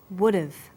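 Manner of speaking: WOULD'VE run together, no H in have; dropped H. Shown as a demonstration. Teacher copying Neil's connected speech, H dropped in WOULD'VE. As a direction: link WOULD'VE as "wood-uv". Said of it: "the h sound is often dropped".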